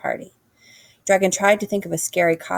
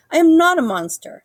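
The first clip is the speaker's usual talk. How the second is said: higher, louder protest; insistent Dragon; 11 semitones higher, 7 dB louder than usual. A narrator's reading. Dragon assuring frightened animals, raised protest. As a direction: plead your case. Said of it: "said Dragon".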